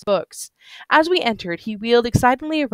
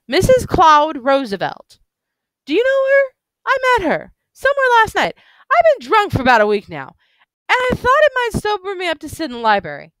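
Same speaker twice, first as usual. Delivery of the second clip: put-on gushing drunk man; 12 semitones higher, 3 dB louder than usual. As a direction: marvel drunkenly at every detail.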